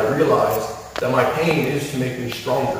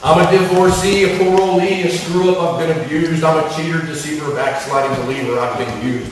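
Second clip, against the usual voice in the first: loud, high, driving litany of labels; preacher's rhythmic confession roll; impassioned list; 5 semitones higher, 6 dB louder than usual. Acted. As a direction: hammer each label home.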